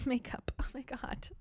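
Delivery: whispered through a laugh; sneaking the pun in with a whispered laugh. Real, not acted. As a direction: breathe the pun out giggling. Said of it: "get it?".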